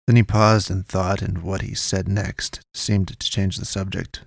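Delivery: plain reading voice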